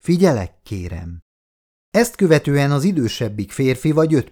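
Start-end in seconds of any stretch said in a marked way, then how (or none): 0.0-1.2 timid. A narrator's reading. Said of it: "félénk hangon megszólalt".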